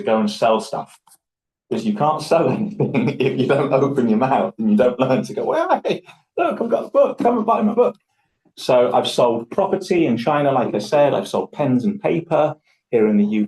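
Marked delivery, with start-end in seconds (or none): 5.4-8.0 much higher, bright hammed-up sales pitch; pushy salesman. Selling a book to passers-by, hammed-up salesman pitch. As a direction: hawk the book like a market trader.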